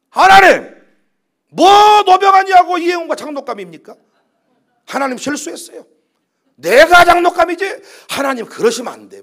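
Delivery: high, loud complaining cry to God; resentful prayer. Retold or imitated. 장로에서 떨어진 교인이 하나님께 원망하는 장면, loud high complaining cry. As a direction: wail your grievance at God. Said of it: "원망이 나왔다는 거예요".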